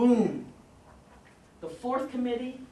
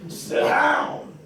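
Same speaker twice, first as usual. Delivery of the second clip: louder, higher stern order; preacher's command; 6 semitones higher, 9 dB louder than usual. Retold or imitated. Tobias ordering Garrick again, sharp loud command. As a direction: bark it so he stays seated.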